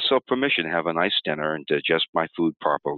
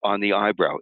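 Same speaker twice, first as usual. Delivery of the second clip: ordinary talk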